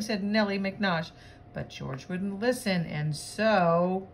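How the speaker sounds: animated, bouncy picture-book reading; lively read-aloud voice; story narration. Acted. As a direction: read it to children with lively swoops and punched words.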